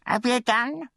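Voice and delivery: very high-pitched query; impatient undertaker's wife. A narrator's reading. Undertaker's wife checks on the boy's supper, high impatient query. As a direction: question the boy in a high mistress voice.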